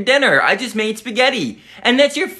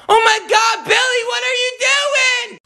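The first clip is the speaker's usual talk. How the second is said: much higher furious screaming; furious shrieking. Acted. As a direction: shriek your rage at a cheating boyfriend. Catches boyfriend, "I'm so angry.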